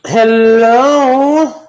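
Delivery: big loud hello to the stream; loud greeting. Real, not acted. Host greeting viewers as the live stream opens, loud and bright. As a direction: call out to everyone joining.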